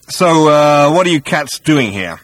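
high, loud, breezy bluff; cocky casual. Acted. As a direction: play it cool and brash.

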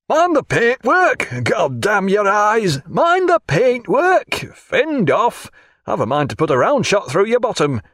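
thundering bellow. Acted. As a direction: bawl down from the deck at a clumsy boat. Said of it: "a thundering voice".

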